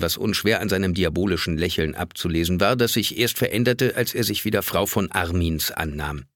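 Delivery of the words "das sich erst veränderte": plain reading voice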